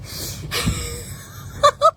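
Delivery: high breathy cackle of laughter; laughing. Real, not acted. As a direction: burst out in a high, helpless laugh. Laughing at the horse's antics.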